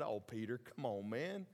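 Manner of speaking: a soft, lightly voiced fond rebuke; gentle scold. Jesus gently chiding Peter, soft and fond. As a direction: chide him tenderly. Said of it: "I don't think it was like a harsh scold".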